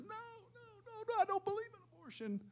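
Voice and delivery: very high, thin little voice pleading; put-on tiny high-pitched objector; mock panicked protest. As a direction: squeal a mock plea of refusal. Voicing the unborn put back in womb.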